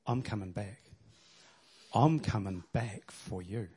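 low, hushed, tender vow; soft earnest promise. Acted. Jesus promising his bride, hushed and tender. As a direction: murmur it like a lover's promise. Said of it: "I've just told you what I went through".

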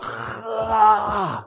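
long rasping "raah" of hunger; ravenous roar. Acted. Preacher acts out devouring books, ravenous drawn-out roar.

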